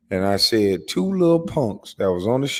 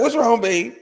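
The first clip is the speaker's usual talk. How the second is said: high, fretful voice of the wife; worried wife; 13 semitones higher than usual. Retold or imitated. Imitating the wife opening the door, worried. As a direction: ask like an anxious partner at the door. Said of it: "So she opened the door".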